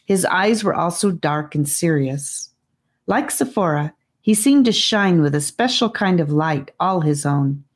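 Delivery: ordinary delivery, nothing marked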